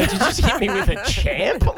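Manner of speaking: indignant retort. Retold or imitated. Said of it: "Yeah, I go".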